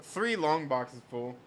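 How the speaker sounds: ordinary talk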